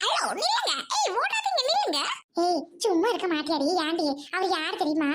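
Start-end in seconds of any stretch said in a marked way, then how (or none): 0.1-2.0 high, urgent calls to stop; calling after someone. Acted. Calling after the fleeing man to stop, high and urgent. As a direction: call out for him to stay.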